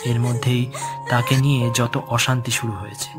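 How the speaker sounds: ordinary delivery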